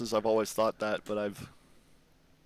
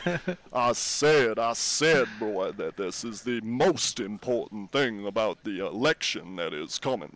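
Warner Brothers rooster; 3 dB louder than usual. Shown as a demonstration. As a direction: open loud and stretched like a Southern cartoon rooster. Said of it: "the rooster from fucking Warner Brothers".

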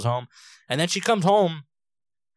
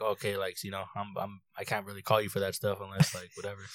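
low, much softer retold answer; subdued reply; 6 semitones lower, 11 dB quieter than usual. Son to mom after she admits he's right, quietly resigned. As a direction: trail down into a quiet, halting resignation.

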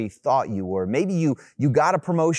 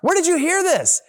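sudden high, loud alarmed question; shocked, panicked reply; 11 semitones higher, 7 dB louder than usual. Retold or imitated. Text from friend's son, read as high loud alarm. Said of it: "She says".

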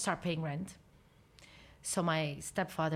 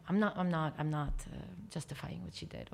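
ordinary talk, nothing marked

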